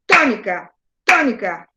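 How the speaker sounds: TÓnica, TÓnica, con golpe en cada TÓ; acento rítmico. Shown as a demonstration. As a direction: marca el ritmo de «tónica» en la primera sílaba. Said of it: "así, con la mano". («tónica» a golpes, acento en TÓ)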